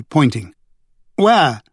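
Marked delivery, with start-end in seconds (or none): 1.2-1.6 sharp high cry. A narrator's reading. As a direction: call out to find him. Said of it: "Victoria cried".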